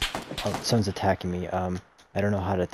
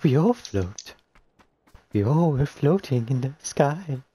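creepy clown voice; 6 semitones higher than usual. Pretend. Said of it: "You have a great clown voice".

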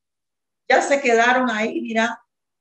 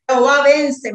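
loud, raised-pitch quote of a yell; retold shout; 4 semitones higher, 7 dB louder than usual. Mother quoting her son's cry as he is taken, retold shout. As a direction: call it out as he shouted it. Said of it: "él me grita y me dice".

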